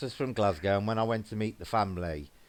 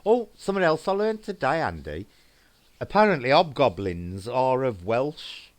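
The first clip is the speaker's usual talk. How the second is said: excited; 4 semitones higher, 6 dB louder than usual. Real, not acted. Eager to share new fact.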